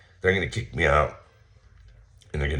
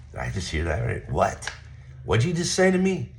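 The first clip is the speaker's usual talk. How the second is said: can't believe what he just heard; incredulous disbelief; 4 semitones higher than usual. Retold or imitated. To the gym manager, stunned by the complaint: a high incredulous what.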